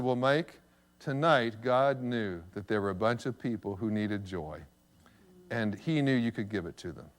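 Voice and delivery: low, soft fatherly explanation; gentle answer. Retold or imitated. Father answering son in the car, low and gentle. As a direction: explain it tenderly, voice dropped low.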